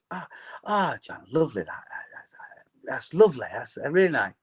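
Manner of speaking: lightly voiced mother, trailing into a whisper; soft, half-whispered show of liking a gift; imitating polite mother. Mother opening her son's present, mildly imitated politeness. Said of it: "as my mother would do".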